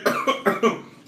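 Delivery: harsh loud coughing fit; spice cough. Real, not acted. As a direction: cough from the heat. Coughing from hot chicken.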